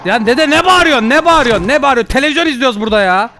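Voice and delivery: loud, high exasperated protest; annoyed shouting. Pretend. Ghost watching TV shouts back at grandpa, exasperated.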